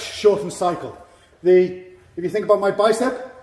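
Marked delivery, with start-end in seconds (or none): none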